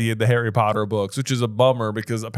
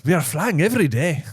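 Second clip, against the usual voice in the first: a bad, half-hearted Russian accent; 4 semitones higher than usual. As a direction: fake Russian accent, slipping toward Irish. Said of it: "I don't know if I did it again".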